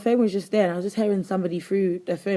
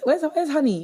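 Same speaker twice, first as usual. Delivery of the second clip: mock friend asking; 9 semitones higher, 4 dB louder than usual. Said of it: "you come up to like".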